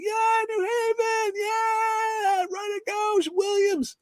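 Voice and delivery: high, far-off cheering yell; cheering shout. Retold or imitated. Fan shouting up to the stage, high excited cheer. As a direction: cheer back at him across the hall.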